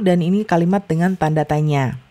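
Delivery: ordinary delivery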